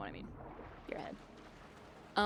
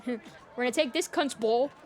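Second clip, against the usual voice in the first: put-on loud laughing Australian accent, getting louder; mock Australian footy player; 5 semitones higher, 6 dB louder than usual. Streamer imitating Australian footballers, loud laughing Aussie accent. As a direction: bellow it like a rough Aussie player, cracking up.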